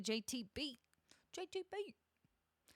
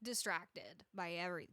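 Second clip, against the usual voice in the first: ordinary talk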